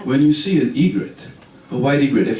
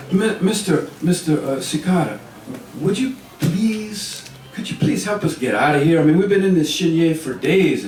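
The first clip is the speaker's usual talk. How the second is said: higher-pitched, halting appeal; pleading; 5 semitones higher than usual. Acted. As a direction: beg a stranger for help, politely. Lost turtle begs a cicada for help.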